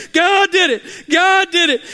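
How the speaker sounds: high, loud shout of praise; the woman shouting thanks to God at her door. Acted. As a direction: throw the praise up to heaven. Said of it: "She's praising".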